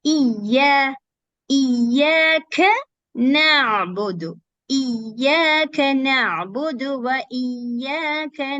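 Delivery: Iyaka with the ya stretched long and slow; stretched sound. Shown as a demonstration. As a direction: stretch the alif in Iyaka slowly. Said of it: "So just stretch this sound".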